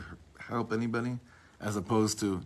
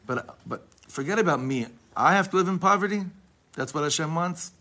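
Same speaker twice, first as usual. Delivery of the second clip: anguished plea; 8 semitones higher, 6 dB louder than usual. Retold or imitated. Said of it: "He's bawling".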